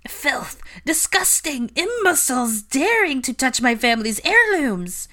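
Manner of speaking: high, loud outraged shriek; screaming with rage. A narrator's reading. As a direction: shriek in scandalized fury, drawing out the insults. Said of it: "screams of rage".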